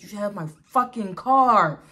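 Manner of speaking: loud retold outburst, hitting the swearing; retold anger. Stranded mother recalling her partner took the car, acted anger. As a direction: act out being furious about the car. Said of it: "angry 'cause it's just like".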